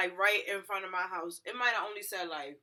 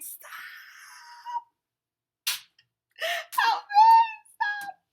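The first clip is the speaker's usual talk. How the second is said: very high screamed plea for help; yelling and crying; 13 dB louder, much slower than usual. Retold or imitated. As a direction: shriek desperately at a passing cab. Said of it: "standing there yelling and crying".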